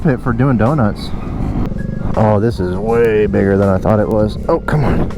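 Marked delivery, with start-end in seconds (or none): none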